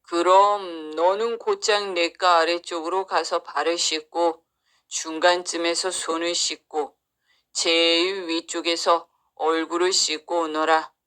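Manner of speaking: low, stately cow giving instructions. Acted. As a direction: instruct as a solemn animal helper.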